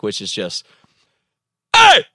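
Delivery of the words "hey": very high, loud sudden "Hey!"; explosive snap at a boundary-pushing kid; loudest possible yell. Shown as a demonstration. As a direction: pop from zero to a hundred. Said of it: "the loudest sound you can make".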